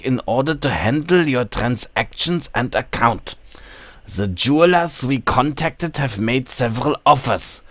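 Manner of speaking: put-on formal letter read in a German accent; put-on German accent, measured reading. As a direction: read it out like a German-speaking banker. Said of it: "I have to read this with a German accent".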